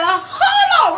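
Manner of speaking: startled yelp of disbelief; retold shocked shout with a laugh. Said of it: "I'm shocked".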